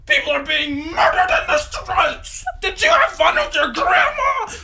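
shrill mock-hysterical social media moralist; hammed-up shrieking scold. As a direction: screech like a preachy online scold.

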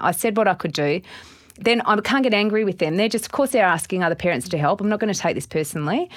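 usual speaking voice

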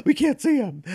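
squeaky mock cry of dismay; high mock voice. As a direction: pitch way up for a panicked line. Mocking a baffled villain.

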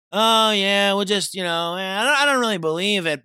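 high, loud, whiny mimic; mocking conformist. Mocking people who just go along, high whiny voice. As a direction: parody the shrug-along believer. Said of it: "that's another thing".

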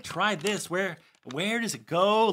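high, puzzled questioning; mock bewilderment. Actor reading scribbled handwriting before a show, mildly baffled. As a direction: play someone lost on the page, rising at the end.

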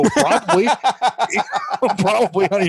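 high, laughing through the word Probably; laughing answer. Retold or imitated. Answering the uncle about the bear, laughing high.

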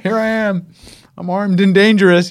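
high, loud hammed-up bravado; mock tough guy. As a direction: clown for the hidden cameras with mock menace. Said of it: "I'm like".

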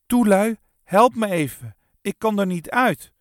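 high, loud plea; calling for help. A narrator's reading. Kees stuck with the Turkish drum, calling out. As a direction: call out to friends for rescue. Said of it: "riep hij".

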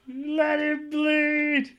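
high, slow mimicked wrestler's holler; mock yelling. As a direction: stretch it into a high, hammed-up yell. Echoing Sid's retold yell.

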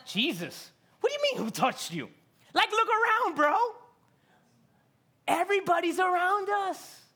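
high, loud exasperated Peter, like a buddy; incredulous Peter. Acted. Peter to Jesus in the crowd, incredulous and loud. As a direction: throw up your hands at Jesus, look around bro. Peter voiced, disbelieving bro.

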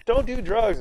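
a quick high mock of a droning principal; mock Skinner. Principal warning the students, in a mock high voice.

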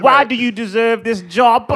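hammed-up English accent, demanding. Shown as a demonstration. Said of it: "when I think English accent".

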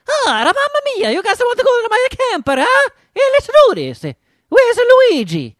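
high, loud Italian caricature voice; booming sing song welcome. Retold or imitated. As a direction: boom it out like a cartoon Italian host. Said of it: "the weirdest voice for his, his dubbing".

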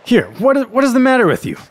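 high-pitched annoyed demand; rough exclamation. A narrator's reading. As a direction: demand to know what's wrong. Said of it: "roughly exclaimed the soba man".

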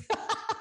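light high-pitched giggle; a soft breathy laugh at herself; quick amused giggle. Real, not acted. Laughs at own point.

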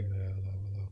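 imitating dad murmuring in his sleep; sleepy murmur.

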